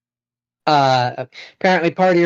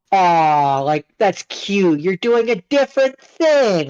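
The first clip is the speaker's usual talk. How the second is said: higher, drawn-out patronizing "aw"; imitating a patronizing admirer; mock condescending cooing; 4 semitones higher, slower than usual. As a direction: coo as if humoring someone, pitch climbing. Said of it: "it doesn't just feel like".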